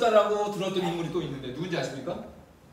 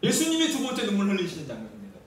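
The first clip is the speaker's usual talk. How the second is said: normal talking voice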